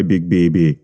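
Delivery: usual speaking voice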